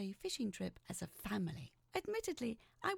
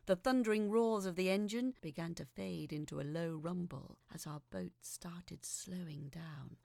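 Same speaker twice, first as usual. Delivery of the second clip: ordinary delivery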